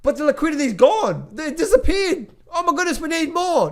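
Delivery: high, loud mock panic voice; mock panicked investor. Worried investors fretting the money's gone, high hammed-up panic. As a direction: ham up a frantic investor crying out. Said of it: "It's like".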